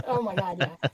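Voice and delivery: ordinary delivery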